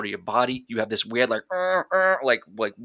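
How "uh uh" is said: high, strained mock panting gasps; imitated hyperventilating gasps. Describing a psychological break to the host, an imitated hyperventilating gasp. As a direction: act out a panicked, breath-out-of-control gasp. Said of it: "weird sound comes out of you".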